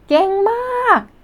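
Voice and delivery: high, quick burst of praise; excited cheer. Real, not acted. Teacher cheering learners at lesson's end, excited.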